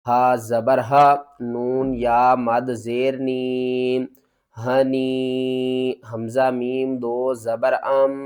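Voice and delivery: syllable by syllable, drawn out; slow spelling out. Shown as a demonstration. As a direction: spell each letter slowly for the student. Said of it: "اس کے ہجے شروع کرتے ہیں". (حروف کو آہستہ آہستہ ہجے کرنا)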